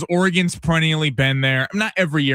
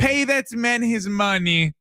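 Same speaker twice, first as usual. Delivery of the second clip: slow, high, savoring movie line; a hint of a movie character; movie quote; 6 semitones higher, slower than usual. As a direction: quote it like the famous scene. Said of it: "Rounders the greatest".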